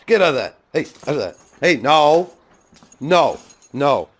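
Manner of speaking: loud, high no; firm scolding. Real, not acted. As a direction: stop the dog firmly. Stopping dog from oily thing.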